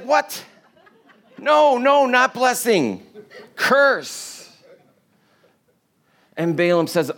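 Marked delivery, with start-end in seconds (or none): 0.0-4.5 high, laughing, incredulous protest; a king spluttering that he got the wrong thing; outraged disbelief. Retold or imitated.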